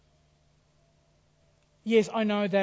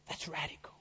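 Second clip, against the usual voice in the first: whisper; 3 semitones lower, 12 dB quieter than usual. Real, not acted. Preacher drops to a hush.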